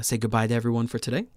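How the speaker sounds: normal talking voice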